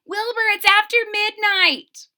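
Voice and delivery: high, loud urgent shout; high, loud warning yell. Acted. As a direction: call out the late hour. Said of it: "shouted Francine".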